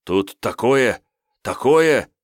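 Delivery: high, loud breathless cry; excited shout. Acted. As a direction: burst in with alarming news. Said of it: "закричал".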